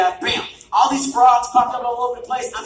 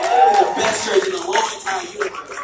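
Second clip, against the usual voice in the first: hyped-up boast. Real, not acted. Preacher to congregation, bragging in a hyped-up voice. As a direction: hype the crowd about his church.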